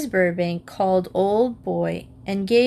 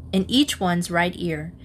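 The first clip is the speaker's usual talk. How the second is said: usual speaking voice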